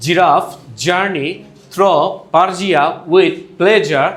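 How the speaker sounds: slow, phrase-by-phrase English demonstration; model reading. Shown as a demonstration. Teacher models J and ZH sounds, slow deliberate reading. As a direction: read it very slowly, word by word, for learners. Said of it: "যেমন আমি যদি বলি".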